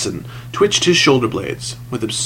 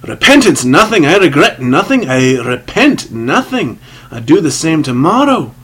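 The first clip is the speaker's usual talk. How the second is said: loud, raised, defiant outburst; cutting him short; 4 semitones higher, 6 dB louder than usual. A narrator's reading. Sinner cutting the priest short, loud defiance. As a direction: cut in and refuse it emphatically. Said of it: "the other cut him short".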